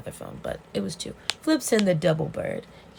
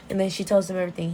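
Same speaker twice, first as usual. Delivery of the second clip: usual speaking voice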